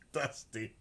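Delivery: ordinary delivery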